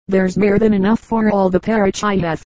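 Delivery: plain reading voice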